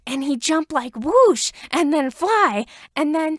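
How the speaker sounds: loud, high breathless retelling; excited kid storytelling. A narrator's reading. Child tells the babysitter about Eraserhead's fight, loud and excited. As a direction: act out the hero's moves with big energy.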